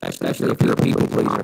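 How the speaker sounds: distorted low warped voice; glitchy robotic connection sound; alien voice. Real, not acted. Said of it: "we sound like aliens".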